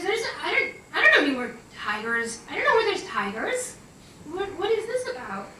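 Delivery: high-pitched, stammering confusion; flustered, incredulous questioning; mock bewilderment. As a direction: replay being totally thrown by a request. Teller baffled by horse's request.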